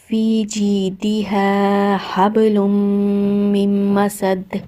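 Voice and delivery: slow, drawn-out melodic tajweed recitation; Quran recitation. Shown as a demonstration. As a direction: chant each word long and measured like a qari.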